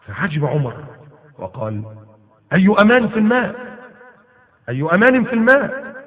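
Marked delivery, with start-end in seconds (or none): 2.5-5.9 higher, louder astonished question. Acted. Umar to Hormuzan, astonished and loud. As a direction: demand it in disbelief.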